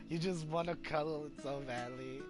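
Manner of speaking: ordinary delivery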